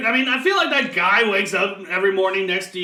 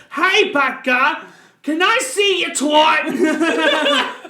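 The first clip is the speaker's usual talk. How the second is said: mock Skype caller; 7 semitones higher, 4 dB louder, much slower than usual. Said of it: "and like".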